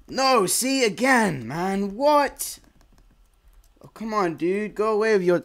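disbelieving gamer outburst; exasperated yells. Real, not acted. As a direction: bark at the unfairness. Killed again.

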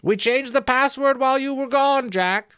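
very high, loud piping voice; sentry's voice. Acted. Sentry explaining the arrow to Jack, high thin voice. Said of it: "came the voice of the sentry".

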